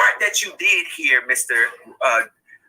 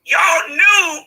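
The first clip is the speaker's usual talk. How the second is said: tearful, raised cry of accusation; crying out the words through real grief; 8 semitones higher, 4 dB louder than usual. Real, not acted. Grieving mother confronts accused families.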